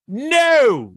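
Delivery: a sharp high retold "No" shouted at a writer; mock yell. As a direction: snap a flat refusal, half-shouting like a rock star. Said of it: "and, like, yell at him".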